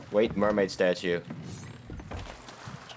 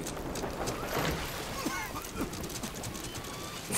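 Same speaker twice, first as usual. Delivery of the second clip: very high, wordless laughter; high giggling fit; 9 dB quieter than usual. Real, not acted. Player cracking up mid-game, high giggling. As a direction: giggle on helplessly.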